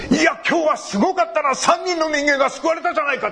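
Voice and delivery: high, proud proclamation; preacher boldly telling his friends on the train about salvations, high and proud; loud bold boast. Retold or imitated. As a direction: announce it big so the whole train hears. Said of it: "でっかいこと言ったら".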